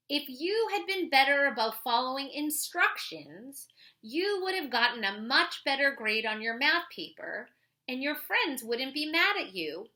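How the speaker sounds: put-on clear, lively read-aloud voice for kids; reading a picture book aloud. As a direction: read it like a story for the class. Reads a children's book aloud.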